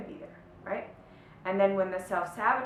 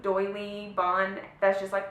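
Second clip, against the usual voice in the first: ordinary talk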